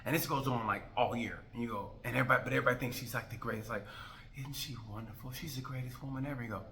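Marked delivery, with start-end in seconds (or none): 4.4-6.4 hushed adoring. Retold or imitated. Mimicking everyone praising her.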